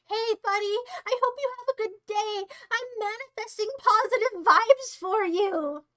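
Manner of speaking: over-the-top friendliness. Pretend. Streamer forcing compliments on a viewer, strained niceness. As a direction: gush with exaggerated, strained sweetness.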